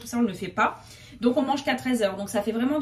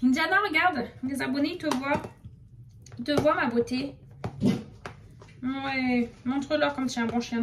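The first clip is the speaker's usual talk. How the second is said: talking to a dog. Real, not acted. Shows the dog to viewers.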